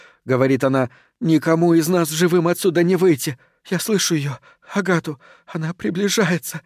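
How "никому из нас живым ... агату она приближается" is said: higher-pitched, subdued dread; fearful confession. A narrator's reading. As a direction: speak high and hushed, as if doomed. Обречённая Кристи говорит на камеру. (обречённый, испуганный высокий голос)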